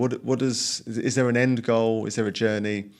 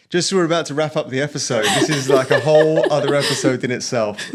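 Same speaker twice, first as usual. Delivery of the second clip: laughing; 4 semitones higher, 6 dB louder than usual. Real, not acted. Host laughs at clickbait remark.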